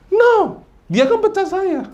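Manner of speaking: exaggerated protest. Mock reply about being fired.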